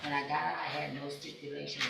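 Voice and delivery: speaking up. Real, not acted. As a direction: repeat it clearly so she hears. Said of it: "Speak up, please".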